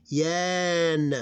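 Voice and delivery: "yan" held long, loud and high; long sound. Shown as a demonstration. Teacher showing the ya is long sound, drawn out. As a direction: stretch the vowel in "yan". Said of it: "long sound".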